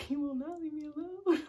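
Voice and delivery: real laughter breaking through; laughing through it. Real, not acted. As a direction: laugh it out in disbelief. Laughing while recounting the harassment.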